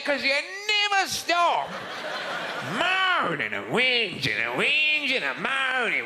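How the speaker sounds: drawn-out, high, slow Aussie jeering; mock Australian whinge. Australian mocking English visitors, drawn-out and high. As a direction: stretch the vowels like a scornful Australian. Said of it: "we call you".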